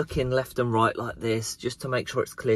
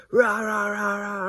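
high, garbled mock rage; mock ranting; 9 semitones higher, slower than usual. Vlogger mimics the furious woman swearing at him, mock rant. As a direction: parody her ranting as wordless noise. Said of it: "She was like".

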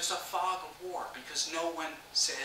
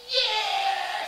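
very high, loud whoop; high-pitched finish-line yell; elated cheer; 7 dB louder than usual. Retold or imitated. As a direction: cheer it out for the camera. Cheers for the photo.

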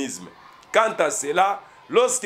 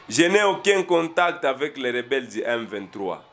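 low, measured reading voice; news quote read-out; 4 semitones lower than usual. Acted. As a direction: read the statement out in a lower register. Lit une déclaration citée du président. (lecture posée d'une déclaration, voix plus basse)

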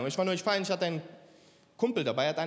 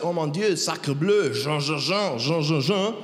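mock French; 2 semitones lower, slower than usual. Comedian showing how French sounds, mock French accent. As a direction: caricature French gibberish sound. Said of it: "Wenn du Französisch hörst".

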